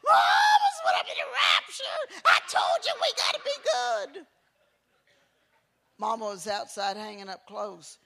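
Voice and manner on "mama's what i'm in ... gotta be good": put-on much higher, louder tearful kid shouting; panicked child wailing. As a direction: cry it out like a terrified boy. Child panics about the rapture.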